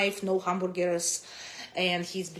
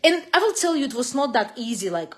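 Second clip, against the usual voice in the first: ordinary talk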